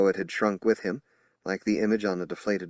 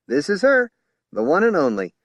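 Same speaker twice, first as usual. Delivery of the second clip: higher, loud proud showing off; proud announcement; 10 semitones higher, 8 dB louder than usual. A narrator's reading. Ice cream man proudly showing off his girl, loud. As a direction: present her with a flourish.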